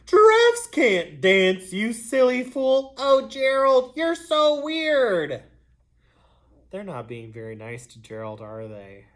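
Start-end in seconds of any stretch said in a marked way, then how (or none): 0.1-5.4 sneering taunt. Acted. Animals mocking Gerald, loud, high, sneering. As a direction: drag out the insult to humiliate him. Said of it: "the animals all sneered".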